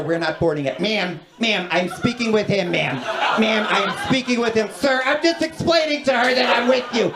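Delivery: increasingly high, testy customer-service voice; cranky gate agent. Retold or imitated. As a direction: imitate a harried airline agent fending off passengers. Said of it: "these cranky airport gate agents".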